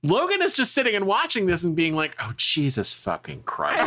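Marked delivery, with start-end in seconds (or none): 2.2-3.9 low, slow, fed-up groan of a line; weary exasperation. Retold or imitated. Friend imitating Logan watching the fight, low slow exasperated mutter. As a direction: sigh it out, utterly over it.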